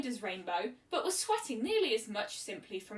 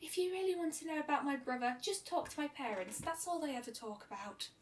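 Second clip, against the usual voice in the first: bitter shy voice; 4 semitones higher, 6 dB quieter than usual. A narrator's reading. Fluttershy bitter about brother.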